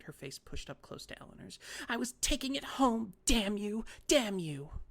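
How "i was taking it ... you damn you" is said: put-on shrill, much higher and louder furious old lady; screaming. A narrator's reading. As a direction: scream it right in her face. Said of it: "the little lady screamed".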